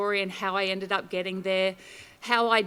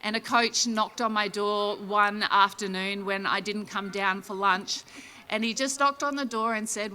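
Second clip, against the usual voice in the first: ordinary talk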